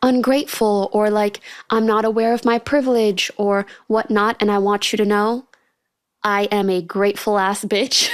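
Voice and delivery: normal talking voice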